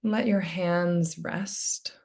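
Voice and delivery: soft, unhurried meditation voice with long pauses; hushed, calming guidance; guided meditation. Real, not acted. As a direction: gently settle listeners into stillness, leaving space between phrases. Leads a meditation.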